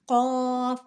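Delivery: قاف from the back with rounded long "aw"; thick q. Shown as a demonstration. Teacher setting it against its twin, قاف thick. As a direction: make قاف heavy and drawn out. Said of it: "پڑھتے ہیں ہمیشہ موٹا".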